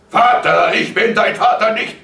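high, very loud shout; outraged disowning; vehement rejection. A narrator's reading. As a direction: fend him off in fury. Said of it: "wehrte der Vater".

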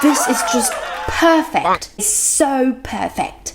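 acted-out compliment, leaning on PER in "perfect"; admiring praise. Shown as a demonstration. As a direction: gush a little, as if praising someone. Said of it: "เหมือนเวลาเราจะชมคนอะ". (ทำท่าชมคนอย่างประทับใจ)

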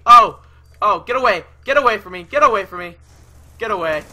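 loud frantic get-away shouts; panicked yelling. Real, not acted. Gamer cornered by an enemy, loud panicked yelling. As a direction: yell it like you're cornered.

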